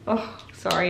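normal talking voice

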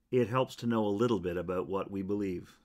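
ordinary talk

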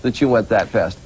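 ordinary talk, nothing marked

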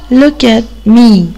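"look at me" stretched out slowly, word by word; slow teaching model. Shown as a demonstration. Teacher modeling for children, slow and clear. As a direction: spell "look at me" out slowly for young learners.

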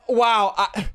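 normal talking voice

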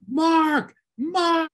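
high, loud urgent call. Retold or imitated. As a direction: call his name across a crowded floor. Acting out a manager calling him.

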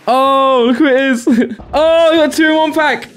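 high, gleeful exclamations; excited. Real, not acted. Streamer gets two pulls in one pack, excited. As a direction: squeal with delight at the surprise. Reacting to double card pull.

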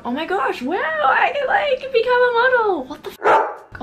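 very high, loud ecstatic shriek; self-mocking squeal. Gullible old self thinks she can become a model, hammed-up squeal. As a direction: build into a hammed-up fangirl scream. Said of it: "egotistical, stupid, self-centered, gullible bitch that I am".

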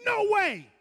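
high shouted refusal; pig's shout. Acted. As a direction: shout it out like an outraged pig. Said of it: "and he says".

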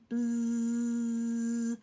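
long, sustained bee buzz; imitated bee buzz.

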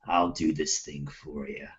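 low, soft, each word hit separately; punctuating each word. Acted. Player threatens racer with knife, low menacing word-by-word. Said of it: "punctuating each word".

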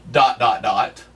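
"Dot, dot, dot." said low and falling; spoken ellipsis. Shown as a demonstration. Preacher voices the title's ellipsis, low and falling. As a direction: drop the voice on each dot, trailing off. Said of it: "What are you trusting in?".

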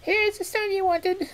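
very high squeaky rat voice; eager, relieved little rodent. Acted. Rat speaks.

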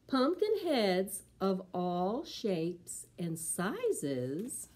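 slow, expressive picture book reading; storybook voice for preschoolers; reading to children. Acted. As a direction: read it slow and lively for little ones.

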